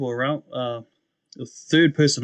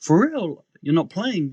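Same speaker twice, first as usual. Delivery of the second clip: much higher, loud, shocked cry; shocked disbelief; 9 semitones higher, 5 dB louder, slower than usual. Retold or imitated. Brother hearing from sister about a crash, shocked. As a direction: cry out in disbelief at terrible news. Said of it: "And so I was like".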